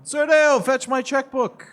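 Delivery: haughty noble command. Acted. Noble orders his servant.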